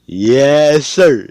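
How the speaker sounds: high, loud, drawn-out cheer; hyped. Real, not acted. Storyteller celebrating the character's name reveal, hyped. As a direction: belt it out for the big reveal.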